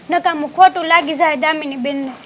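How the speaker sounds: normal talking voice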